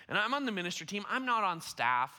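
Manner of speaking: ordinary talk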